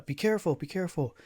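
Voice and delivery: lightly acted urgent caution; voiced warning. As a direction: call a warning from behind a door. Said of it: "is sort of saying".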